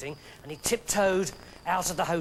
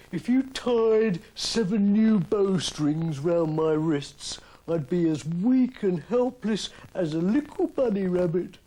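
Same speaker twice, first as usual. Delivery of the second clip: Samson voice; 4 dB louder, slower than usual. A narrator's reading.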